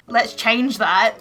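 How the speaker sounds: usual speaking voice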